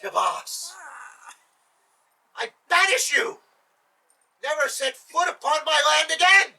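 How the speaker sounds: high, loud enraged scream. A narrator's reading. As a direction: scream out a banishment. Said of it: "and he screamed".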